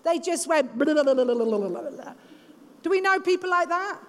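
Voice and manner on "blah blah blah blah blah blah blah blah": rapid run-on babble; imitating unfiltered gossip; mindless blabbering. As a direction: rattle it off without a break, trailing away. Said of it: "people that had no boundaries. They just went".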